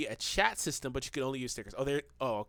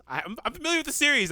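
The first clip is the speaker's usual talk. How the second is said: flustered; 11 semitones higher, 6 dB louder, faster than usual. Real, not acted. Defensive laughing self-justification.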